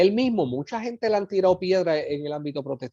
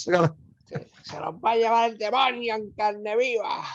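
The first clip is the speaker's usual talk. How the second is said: higher fire-and-brimstone preacher voice; imitating the preacher; 5 semitones higher than usual. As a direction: warn the crowd like a revival preacher. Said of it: "Así decía". (imitando a un predicador apocalíptico, más agudo)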